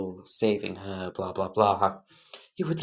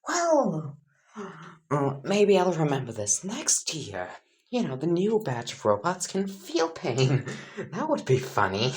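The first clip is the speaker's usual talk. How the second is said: higher, gleeful voice with a grin; sadistic grin; 6 semitones higher than usual. Acted. Nezu muses on robots that feel pain, sadistic grin. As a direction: grin through it like a cheerful sadist. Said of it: "with a sadistic grin on his face".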